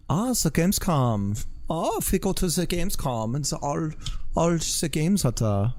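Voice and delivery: hammed-up German accent; horrible movie German accent. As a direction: put on a bad movie-German voice. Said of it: "my horrible accent".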